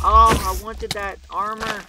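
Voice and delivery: high, loud, drawn-out lament; dismayed wail. Real, not acted. Gamer losing armor they wanted, dismayed wail. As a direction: wail slowly over the loss.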